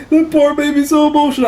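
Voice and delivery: high, quick mock weepy voice; mock crying. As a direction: imitate a blubbering vlogger. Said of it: "some guy was crying".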